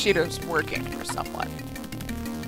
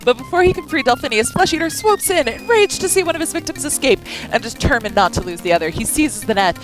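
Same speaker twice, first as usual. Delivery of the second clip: higher, tense action narration; dramatic narration; 4 semitones higher than usual. A narrator's reading. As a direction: read the attack with drama. Reads game narration.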